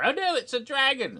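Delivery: high, loud cry of alarm; hammed-up stage fright at a monster; mock panic. Characters spot the scary dragon, mock panic.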